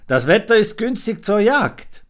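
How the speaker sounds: high, loud scheming fox; hint at the hunt slyly. A narrator's reading. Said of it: "grüßte er".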